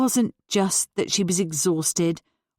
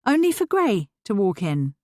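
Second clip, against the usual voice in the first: ordinary delivery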